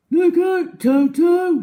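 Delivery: high, loud warning shout. A narrator's reading. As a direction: yell the warning across the jungle. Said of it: "shouted Humpy Rumpy".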